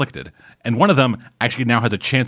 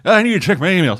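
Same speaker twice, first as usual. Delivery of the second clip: grandpa voice; 8 semitones higher than usual. Retold or imitated. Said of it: "It's like".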